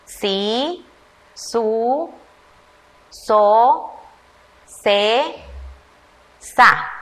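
put-on slow, separate syllables read aloud for children; syllable drill. Shown as a demonstration. Teacher reading the Z syllables to young pupils. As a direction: say each syllable on its own. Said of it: "Todos entonces vamos a leer".

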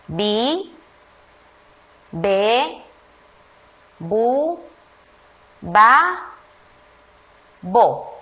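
put-on slow, separate syllables read aloud for children; syllable drill. Shown as a demonstration. Teacher reading the B syllables to first graders. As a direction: say each syllable on its own. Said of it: "Todos leamos".

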